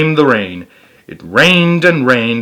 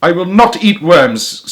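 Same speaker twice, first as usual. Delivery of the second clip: stubborn; 6 semitones higher, 4 dB louder, slower than usual. A narrator's reading. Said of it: "said Philip".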